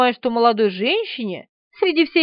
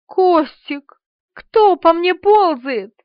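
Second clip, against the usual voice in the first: higher, drawn-out anxious question; rising dread, each word stretched; frightened; 5 semitones higher, 4 dB louder, much slower than usual. A narrator's reading. Woman asks Kostik what crawls on her, frightened.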